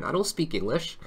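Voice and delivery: normal talking voice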